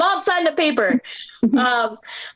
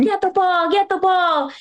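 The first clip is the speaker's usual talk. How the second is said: raised-pitch imitation of screaming grandfather; mock screaming; 5 semitones higher than usual. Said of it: "screaming every game".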